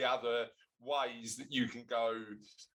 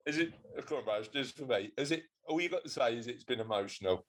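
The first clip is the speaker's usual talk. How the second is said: normal talking voice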